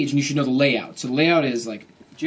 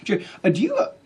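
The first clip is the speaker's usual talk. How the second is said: high, halting awkward greeting; super awkward; 6 semitones higher than usual. Retold or imitated. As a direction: stammer through a painfully awkward approach. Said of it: "gonna be super awkward".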